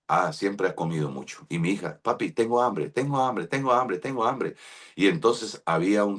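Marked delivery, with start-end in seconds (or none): 2.0-4.6 hungry little daughter. Retold or imitated. Daughter begging for food.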